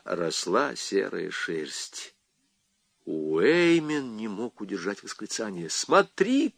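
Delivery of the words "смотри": crying out. A narrator's reading. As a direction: cry out in amazement, voice jumping up. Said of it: "крикнул он". (изумлённый вскрик)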